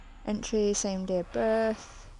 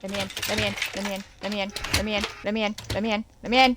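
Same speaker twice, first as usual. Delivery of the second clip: frantic pleading, building louder; being chased; 3 dB louder, faster than usual. Real, not acted. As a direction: beg to be let in, rising panic. Being chased, begging at door.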